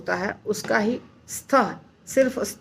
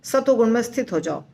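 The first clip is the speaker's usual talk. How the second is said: usual speaking voice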